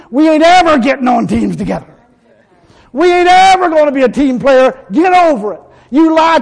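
loud, high and defiant, hammering each word; fiery defiant retort. Real, not acted. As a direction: throw it right back at them. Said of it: "I just tell them straight out".

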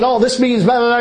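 a hint of self-important genius parody; know-it-all. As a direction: rattle off blahs as a mock expert. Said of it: "he was like, you know, Mister Genius".

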